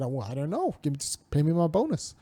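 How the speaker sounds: low, soft offhand mumble; mock indifference. Mimicking people who just kinda said it, mock indifferent.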